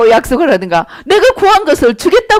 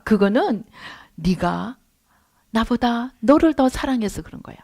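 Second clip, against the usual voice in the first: God's gentle rebuke; 3 semitones lower than usual. Retold or imitated.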